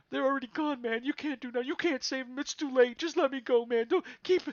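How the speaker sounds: high, loud, frantic begging prisoner; panicked pleading. Acted. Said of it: "he's crying, sobbing".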